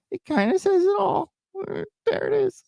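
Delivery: squeaky high put-on voice; silly falsetto voice. Vlogger mocking the chart in a silly high voice. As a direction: pipe it up like a cartoon.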